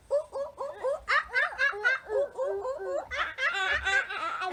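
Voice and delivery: high, excited monkey hoots; rapid ooh-ooh ah-ah chatter; monkey calls. Acted. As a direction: whoop like a playful monkey. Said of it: "hang like a monkey".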